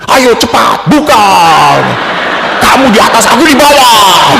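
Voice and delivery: high, loud barked orders; mock harsh shouting. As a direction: imitate a loud Batak husband ordering his wife. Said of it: "memang dia ngomongnya keras". (menirukan suami membentak dengan keras)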